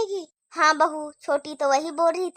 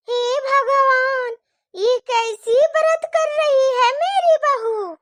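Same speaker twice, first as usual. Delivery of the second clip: mock wail; 5 semitones higher, slower than usual. Saas laments bahu's fasting.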